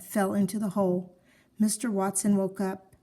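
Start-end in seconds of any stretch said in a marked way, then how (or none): none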